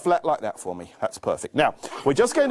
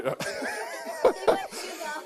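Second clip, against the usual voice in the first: laughing; 7 dB quieter than usual. Real, not acted. Laughs at helper.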